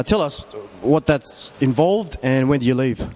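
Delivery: usual speaking voice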